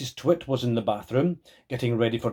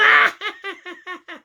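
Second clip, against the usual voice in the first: very high witchy cackle; evil cackle; 5 dB louder than usual. Acted. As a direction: cackle with spiteful glee. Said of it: "cackled Missus Twit".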